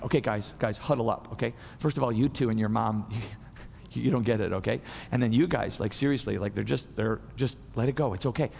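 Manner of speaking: calm them down like a kind coach; tender Jesus. Retold or imitated. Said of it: "notice he's so tender".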